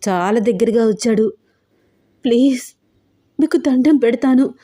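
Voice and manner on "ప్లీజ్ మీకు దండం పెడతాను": higher, begging through tears; tearful begging girl; emotional crying plea. Acted. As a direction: plead desperately, near sobbing. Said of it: "రూప చాలా ఎమోషనల్గా ఏడుస్తుంటే".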